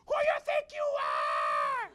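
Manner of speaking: very high, loud, drawn-out mock screech; offended screaming. Offended ladies turning on the man in line, screaming. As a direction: shriek back like an affronted loudmouth. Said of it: "they started screaming even more".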